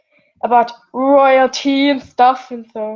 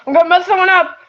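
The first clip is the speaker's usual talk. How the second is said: mock threat; 8 semitones higher, 4 dB louder, much faster than usual. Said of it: "I'm just kidding".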